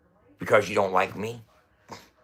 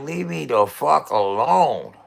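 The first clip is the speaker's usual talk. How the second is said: mock surrender; 5 semitones higher, 4 dB louder than usual. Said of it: "I'll be like".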